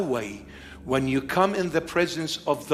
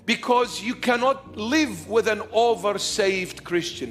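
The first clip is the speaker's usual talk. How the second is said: raised, driving sermon voice; full-force preaching; 5 semitones higher than usual. Acted. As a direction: preach it hard to the congregation.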